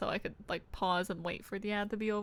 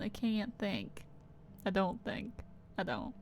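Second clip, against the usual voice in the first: quiet; 9 dB quieter than usual. Real, not acted. Self-mocking, fading.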